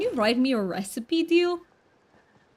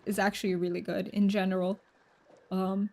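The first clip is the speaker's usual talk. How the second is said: normal talking voice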